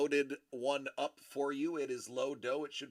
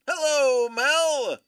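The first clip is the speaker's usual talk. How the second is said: calling out; 10 semitones higher, 10 dB louder, slower than usual. Real, not acted. Calling out a greeting.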